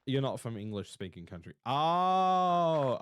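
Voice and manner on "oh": surprised oh. Real, not acted. Realizing viewer isn't native speaker.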